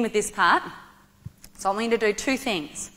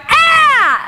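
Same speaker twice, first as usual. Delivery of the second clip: high, loud ki up shout; demonstrated yell; 11 dB louder than usual. Shown as a demonstration. Instructor shows audience how to yell with volunteer, loud shout. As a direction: let out one explosive "Ah!". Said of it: "I want you to go".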